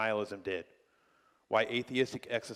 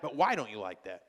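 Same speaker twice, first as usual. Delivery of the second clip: normal talking voice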